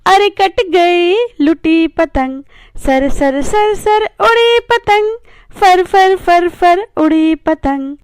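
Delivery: put-on rhythmic chanted children's poem; poem recitation. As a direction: chant the kite verses with a bouncing beat.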